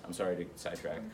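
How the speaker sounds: ordinary delivery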